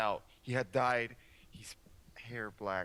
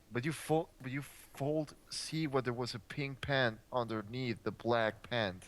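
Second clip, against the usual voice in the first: normal talking voice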